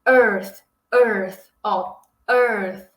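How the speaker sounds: Earth with the tongue between the teeth and a small puff of air; careful Earth demo; English Earth. Shown as a demonstration. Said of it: "posicionar a sua língua entre os dentes".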